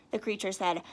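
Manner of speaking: plain reading voice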